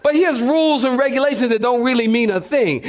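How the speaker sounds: ordinary talk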